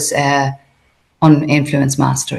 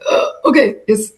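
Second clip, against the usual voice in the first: meek surprised agreement; 6 semitones higher than usual. Retold or imitated. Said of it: "And I was like".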